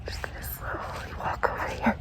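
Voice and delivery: hushed sneaking whisper. Real, not acted. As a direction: whisper while creeping closer. Sneaking up to the house.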